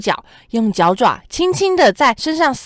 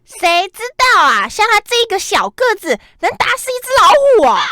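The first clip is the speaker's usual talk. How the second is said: high, fast, louder disbelief; doubtful scoffing; 10 semitones higher, 5 dB louder than usual. Acted. Friends doubting the tiny ant, high scoffing disbelief. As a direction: scoff that such a little guy could do it.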